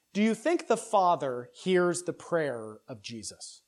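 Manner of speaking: ordinary talk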